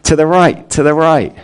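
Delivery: high-pitched mock fatherly call. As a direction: call across the pool to steer a child. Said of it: "again, the dad calls out".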